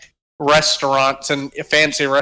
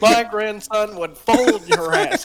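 mock grandpa boast; 7 semitones higher than usual. Voicing grandpa to cops.

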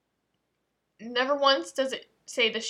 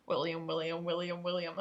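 didgeridoo drone; 5 semitones lower, 5 dB quieter than usual. Shown as a demonstration. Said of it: "that sounds like a didgeridoo".

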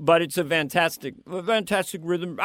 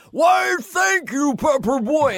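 put-on much higher, loud, slow shout of "Why, thank you, Pepper Boy"; Farley's shouted line; 11 semitones higher, 5 dB louder, slower than usual. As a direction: bellow it high and stretched, breaking into a laugh. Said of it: "Chris went".

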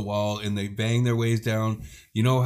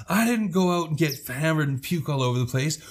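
higher-pitched acted protest with swooping emphasis; indignant retort; 4 semitones higher than usual. Handy countering the story told on her, indignant retort. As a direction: throw the blame back, stressing the accusing words. Retold counter-accusation in sisters' quarrel.

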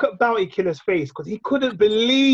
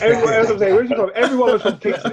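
raised pitch, laughing through the words; laughing teasing; 4 semitones higher, 4 dB louder than usual. Real, not acted. Teasing that everyone claimed to be from Kingston, amused and laughing. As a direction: crack up while making the point.

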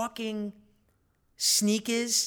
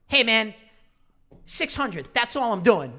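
put-on louder, swaggering kid voice; cocky teen dealer; 3 semitones higher, 7 dB louder than usual. Fourteen-year-old dealer stating his price to buyer, cocky and loud. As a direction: play the tough little hustler. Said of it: "he goes like".

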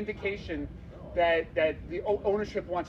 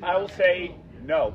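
loud into the mic; 7 dB louder than usual. Real, not acted. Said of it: "speak loudly into the mic".

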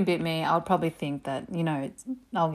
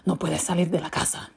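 strict mum; 3 dB louder than usual. Retold or imitated. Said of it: "She'd be like".

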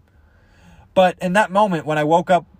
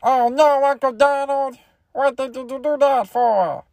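high-pitched duckling voice, slowing at the end; cartoon duck nephew; 8 semitones higher, slower than usual. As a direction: whine like a dismayed cartoon duckling. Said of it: "nephews sound like exactly what you want".

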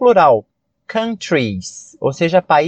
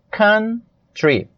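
"Country" split slowly into "coun... try"; "Country" drawn out for learners, a gap before "try"; syllable by syllable; 4 semitones higher than usual. Shown as a demonstration. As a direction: break "Country" in two with a clear pause. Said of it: "Porém, a pronúncia".

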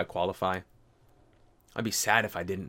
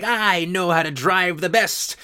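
vindictive road rage; 4 semitones higher, 5 dB louder than usual. Retold or imitated. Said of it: "that vindictive road rage of like".

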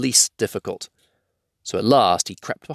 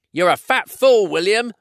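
high, loud troll insult; indignant, raised troll voice; quarrelling troll; 11 semitones higher, 5 dB louder than usual. Acted. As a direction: hurl an insult at your mate.

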